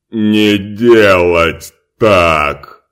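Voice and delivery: loud, very slow broken-speech order; slow booming command. Acted. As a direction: repeat the order word by word. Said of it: "повторил он".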